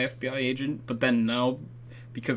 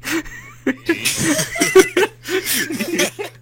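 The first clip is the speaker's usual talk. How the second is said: high giggle; 6 dB louder than usual. Real, not acted. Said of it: "are we doing a whispering thing now?".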